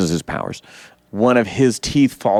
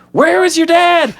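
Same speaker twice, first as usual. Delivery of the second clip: high, louder incredulous question; mock outrage; 5 dB louder than usual. The speaker reacting to the movie, high loud mock outrage. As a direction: shriek the question in mock disbelief. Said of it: "And I'm like".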